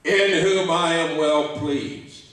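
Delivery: slow, solemn voice of God from above; voice from the cloud. Retold or imitated. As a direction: announce it slowly like a voice from heaven. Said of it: "a voice comes out of the cloud".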